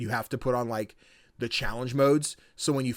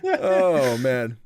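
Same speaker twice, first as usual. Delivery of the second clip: laughing and louder; 3 semitones higher, 6 dB louder than usual. Real, not acted. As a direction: say it through laughter. Laughing at own joke.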